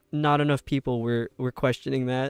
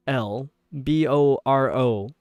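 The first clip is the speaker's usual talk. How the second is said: ordinary talk, nothing marked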